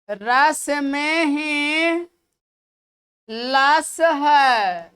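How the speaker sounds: very slow, word by word; slow memory trick. Shown as a demonstration. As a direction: drag out each word to memorize. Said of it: "ऐसे याद रखिए". (याद कराने वाला बहुत धीमा लहजा)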